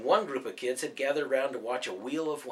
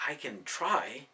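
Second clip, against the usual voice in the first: hesitant reply; 6 dB quieter, slower than usual. A narrator's reading. Rachel hesitates, unsure.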